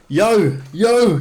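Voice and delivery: loud, high mock rap call; imitated battle-rap shout; imitating Australian battle rappers. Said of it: "when they battle rapped against the New Zealand guys".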